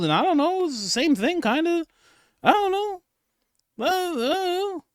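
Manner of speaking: mock nurse answering. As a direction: squeak it out like a flustered interviewee. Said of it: "look at her face when she answers".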